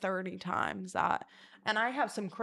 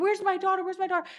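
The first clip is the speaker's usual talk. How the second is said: frantic mom; 10 semitones higher, 7 dB louder, faster than usual. Retold or imitated. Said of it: "She's like".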